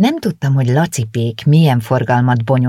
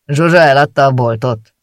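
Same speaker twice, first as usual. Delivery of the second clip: curt, louder gruff baker voice; 5 dB louder than usual. A narrator's reading. Suspicious baker curtly asks the new shopkeeper, louder. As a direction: snap the question at the stranger. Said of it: "kérdezte kurtán".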